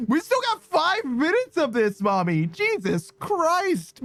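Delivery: high, drawn-out despairing groan; exasperated whine. Real, not acted. Streamer stuck in a failing shout-out, high exasperated whine. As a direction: whine it out, slow and strained.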